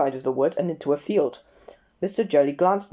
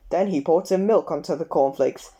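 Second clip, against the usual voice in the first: plain reading voice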